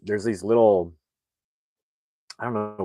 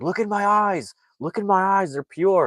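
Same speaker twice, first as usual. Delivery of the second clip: high, pleading voice; pleading father; 13 semitones higher, 6 dB louder than usual. Retold or imitated. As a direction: beg them to look, pitched high. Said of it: "he's like".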